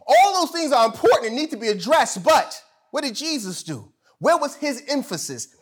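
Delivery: high, emphatic pulpit delivery; fired-up sermon turn; full-force preaching. Real, not acted. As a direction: drive the question home to the congregation.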